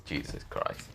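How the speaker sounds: breathy sigh of exasperation; exasperated sighed mutter. Acted. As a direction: sigh the words out, worn out. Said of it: "Ichuka just sighs and says".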